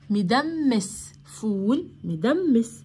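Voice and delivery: slow "مدمس فول مدمس", each syllable clear; careful teacher pronunciation; slow model word. Shown as a demonstration. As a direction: say the word carefully for learners to copy. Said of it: "بصوا كده فول".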